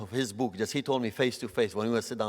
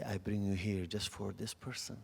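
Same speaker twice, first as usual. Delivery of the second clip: much softer, gentle and hushed; quiet voice of God; 3 semitones lower, 10 dB quieter than usual. Retold or imitated. God speaks to the disappointed preacher, hushed and gentle. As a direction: speak it softly as a calm inner voice. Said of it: "I hear God's voice".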